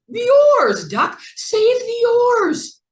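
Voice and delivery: high squeaky urgent shout; urgent squeak. A narrator's reading. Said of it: "Then Mouse squeaked".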